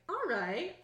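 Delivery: a hint of an intrigued bouncer. Bouncer kinda into the new arrival's look, lightly acted. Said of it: "the bouncer is like".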